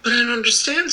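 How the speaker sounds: put-on high, puzzled girl's voice; baffled and lost; bewildered. A narrator's reading. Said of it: "in bewilderment".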